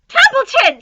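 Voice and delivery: very high, loud scream of a name. Acted. Wilbur screaming at sleeping Templeton, very high and loud. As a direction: scream the rat awake. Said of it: "screamed Wilbur".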